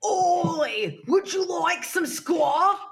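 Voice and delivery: mock British bartender. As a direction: bark the offer like a cartoon London barman. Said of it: "The U K bartender".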